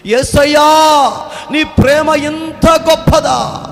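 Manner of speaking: slow, high, loud shout to Jesus; kneeling soldier crying out in wonder; awestruck cry. Acted. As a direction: cry out overwhelmed on your knees. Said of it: "మోకాళ్ళ మీదకి వచ్చి".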